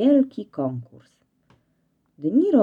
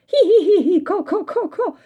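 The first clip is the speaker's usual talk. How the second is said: very high clucking laugh, louder; hen cackling laughter; 13 semitones higher, 5 dB louder than usual. Acted. Hen laughing at the kittens, clucking cackle. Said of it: "gdakała kura gdaczka".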